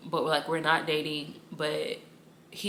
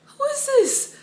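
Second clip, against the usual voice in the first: amazed squeal; 7 dB louder, slower than usual. Retold or imitated. Amazed, repeat goes far higher.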